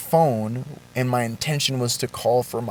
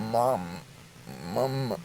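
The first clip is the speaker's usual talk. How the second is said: faint, broken call for his mother, much softer; weak call for mom; 3 semitones lower, 9 dB quieter than usual. Retold or imitated. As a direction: barely get the words out, frightened and helpless. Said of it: "I'm going to recreate it a little bit".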